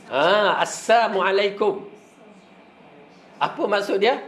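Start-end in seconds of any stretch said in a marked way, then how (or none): 0.6-1.9 "Assalamualaikum" said slowly as "samualaikum" with no L; as-samu greeting. Shown as a demonstration. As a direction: sound the "Assalamualaikum" as "as-samu alaikum", dropping the lam. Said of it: "bukan assalam".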